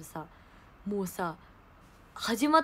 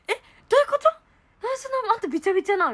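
high, raised amazed question; mock astonishment; 9 semitones higher, faster than usual. Quoting her friend shocked at her drenched face. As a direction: act shocked at the soaking.

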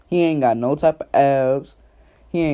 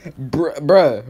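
usual speaking voice

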